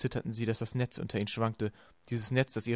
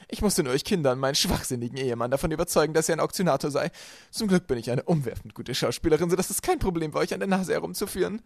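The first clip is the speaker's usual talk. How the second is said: loud, higher-pitched self-satisfied voice; boastful villainess; 5 semitones higher, 6 dB louder than usual. A narrator's reading. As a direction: brag loudly about fooling them. Villainess boasts of her trick. (prahlerisch, laut und erhöht)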